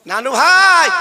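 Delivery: calling out. Acted. Grandfather calling his sleeping grandsons, loud call. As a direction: call out to rouse the children. Calls grandsons again, now louder.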